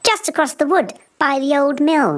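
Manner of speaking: put-on high chatty girl's voice; lightly voiced little girl. Girl giving the wolf directions, high and eager. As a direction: speak up like an eager child.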